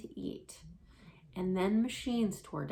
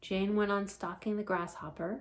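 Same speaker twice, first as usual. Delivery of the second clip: plain reading voice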